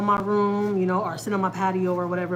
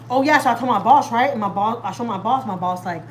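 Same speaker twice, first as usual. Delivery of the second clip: normal talking voice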